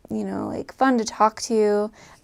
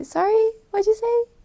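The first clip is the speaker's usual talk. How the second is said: high-pitched polite request to repeat; demonstrated courteous "pardon me" tone; polite query; 12 semitones higher than usual. Shown as a demonstration. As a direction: ask sweetly, as if you missed it. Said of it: "proper or common to say".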